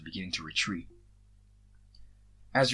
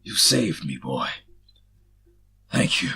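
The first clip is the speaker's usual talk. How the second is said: rough warrior; 5 semitones lower, 8 dB louder, slower than usual. A narrator's reading. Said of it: "His voice was rough and battle-hardened".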